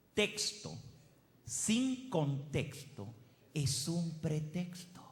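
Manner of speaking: slow, measured saying with pauses; máxima pausada. Real, not acted. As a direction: spell out the maxim slowly, phrase by phrase. Presenta la máxima con pausas. (máxima dicha despacio y con pausas)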